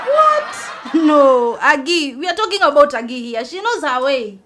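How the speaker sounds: stunned and loudly incredulous; shocked disbelief. Acted. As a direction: blurt it out, refusing to believe it. Shocked by news of a pregnancy.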